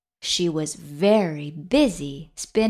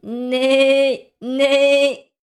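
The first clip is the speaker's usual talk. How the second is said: high, loud imitated neigh; horse neigh; 5 semitones higher, 6 dB louder than usual. A narrator's reading. Horse greets the spider, neighing. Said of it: "said the horse".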